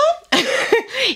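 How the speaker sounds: short high-pitched giggle; high squealing laugh. Real, not acted. Vlogger amused by her toy rabbit, high giggle.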